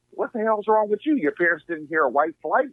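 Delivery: imitating an incredulous rider, pitched high; retold incredulity. Young rider challenging the Uber driver, retold incredulity. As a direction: challenge them in mock disbelief.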